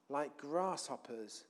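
slow, soft and low; hushed, small. Acted. Spies confess how small they seemed, hushed and slow. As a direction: let it shrink away quietly, word by word. Dwelling on a telling phrase.

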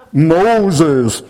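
loud booming call of God; loud calling voice from the burning bush. Retold or imitated.

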